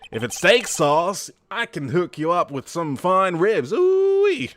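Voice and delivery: high, loud American cowboy, whooping at the end; American accent. Acted. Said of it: "how's my American accent".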